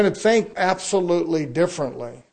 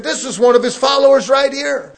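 high, loud pointing accusation; accusing bystander; 8 semitones higher, 8 dB louder than usual. Retold or imitated. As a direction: call him out to the crowd, high and loud.

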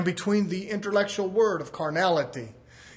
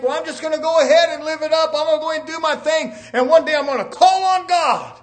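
higher, louder, quick acted boast; mock bravado; 7 semitones higher, 8 dB louder than usual. Preacher imitating people who plan to repent later, mock bravado. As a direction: preach it as a heedless sinner's boast. Acts out a careless believer.

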